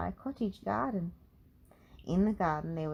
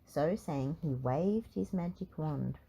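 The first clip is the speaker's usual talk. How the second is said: plain reading voice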